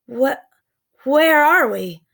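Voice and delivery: halting, rising stammer. A narrator's reading. Jack stammering at the strange prehistoric view. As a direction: stumble over the words in shock. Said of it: "stammered Jack".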